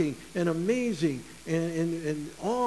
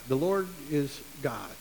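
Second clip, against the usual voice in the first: ordinary talk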